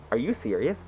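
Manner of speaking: low, soft imitated question; hushed disbelief. Girlfriend checking if he means it, hushed disbelief. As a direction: ask it quietly, suddenly in earnest.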